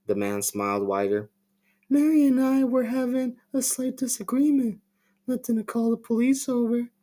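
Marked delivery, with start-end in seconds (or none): 1.9-6.9 much higher, eerie put-on voice; creepy Tommy voice. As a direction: play him as an unsettling smiling stranger. Tommy explains to police.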